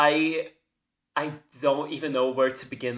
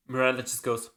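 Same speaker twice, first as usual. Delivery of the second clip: ordinary talk, nothing marked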